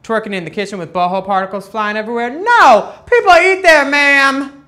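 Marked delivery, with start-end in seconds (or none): 2.3-4.6 high, loud indignant scold; sassy scolding. Pretend. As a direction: tell her off like a fed-up auntie. Scolding the dancer.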